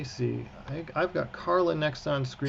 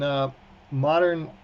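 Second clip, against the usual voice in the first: usual speaking voice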